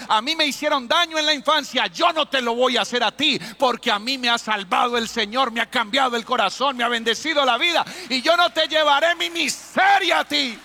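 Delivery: loud, high preaching vow; fervent declaration. Acted. Preacher voices a healed parent promising their child, loud and fervent. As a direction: proclaim it like a testimony, rising to a peak.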